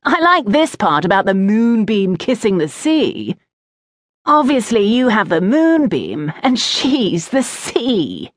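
put-on jeering boy, slowing on the punchline; taunting schoolboy. A narrator's reading. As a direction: savour the silly line teasingly. Oakenrot mocks moonbeam line.

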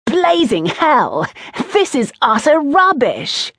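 high, emphatic schoolboy exclamation; gleeful scorn. A narrator's reading. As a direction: crow with mocking disbelief. Oakenrot gleefully mocks the poem.

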